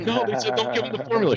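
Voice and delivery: high-pitched mock whine; imitating panicked wife. Wife arguing with her mom, high mock whine. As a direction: whine it way up like a frazzled new mom. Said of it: "She's like".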